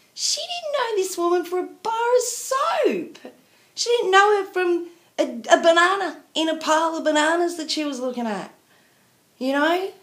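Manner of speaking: high, indignant and fast; indignant high-pitched outburst; cross rant. Real, not acted. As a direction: spit it out in disbelief, pitch way up. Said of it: "I'm really cross".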